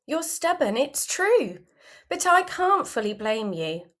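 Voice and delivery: plain reading voice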